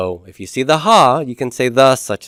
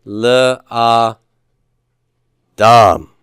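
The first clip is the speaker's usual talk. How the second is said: "Le, a, dam" syllable by syllable, "dam" loudest; "Le... a... DAM", stress on the last syllable; 5 dB louder, much slower than usual. Shown as a demonstration. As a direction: break up "Le, a, dam", pausing before a punched final "dam". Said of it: "Where's the stress on this word?".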